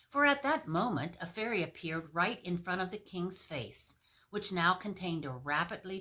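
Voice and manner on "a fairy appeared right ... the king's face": ordinary delivery, nothing marked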